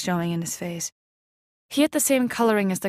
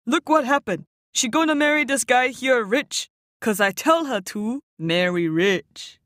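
uncle explaining joke; 4 semitones higher than usual. A narrator's reading. Uncle Tin explaining his joke to Lisa, high and proud. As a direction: spell out the joke, delighted with himself.

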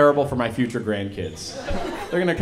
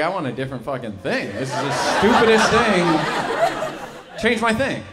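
slow, louder fed-up complaint, stretching stupidest; exasperated rant; 4 semitones higher, 5 dB louder than usual. Acted. Comic to the crowd, fed up with the pandemic. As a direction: whine to be given a different era.

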